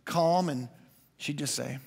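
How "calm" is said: normal talking voice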